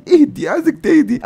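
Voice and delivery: high, hammed-up soothing voice; high mock comfort for the crying wife. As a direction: coo at her to calm down, half joking.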